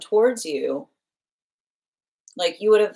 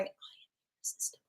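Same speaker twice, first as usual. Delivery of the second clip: tiny whispered boast; faint whispered claim; small whispering voice; 21 dB quieter, much faster than usual. Retold or imitated. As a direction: whisper it like a secret. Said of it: "a small voice going".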